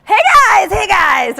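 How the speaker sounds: loud, high, over-the-top cheery "hey guys"; mock booth greeter. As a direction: ham up a salesman hailing passers-by. Mimics expo booth greeters.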